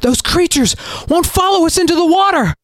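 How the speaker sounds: high shout while running; breathless shout. A narrator's reading. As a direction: yell it over your shoulder. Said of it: "she shouted to Galen, nearly out of breath".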